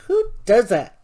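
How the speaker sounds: high, loud, outraged question; indignant exclaim; indignant outburst. Retold or imitated. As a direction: throw the question out in disbelief. Retold outraged question to store.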